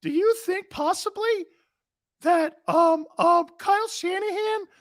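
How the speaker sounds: timid falsetto radio voice, full of ums; very high, tentative caricature; high-pitched West Coast host. Shown as a demonstration. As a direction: squeak out hesitant questions as a mock West Coast host. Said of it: "I'm talking in that high-pitched voice".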